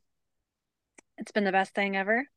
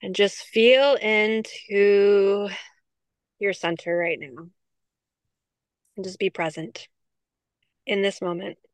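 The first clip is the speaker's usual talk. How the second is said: guided grounding. Acted. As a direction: ease listeners into their breath. Leads a grounding exercise.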